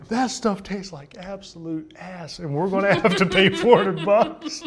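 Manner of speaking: high, slow, outraged complaint; mad rant. Retold or imitated. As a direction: rage at paying for bad caviar. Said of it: "He was so mad".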